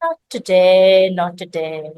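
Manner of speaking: serene and unhurried; calm meditating hen. Acted. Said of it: "And again she nodded".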